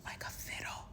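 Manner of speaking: whispered knowing aside. Retold or imitated. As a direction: breathe it out like a secret. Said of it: "I'm like".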